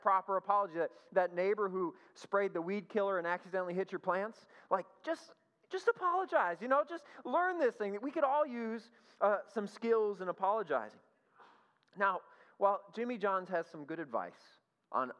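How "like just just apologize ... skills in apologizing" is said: exasperated plea. Real, not acted. Exasperated plea to rude people.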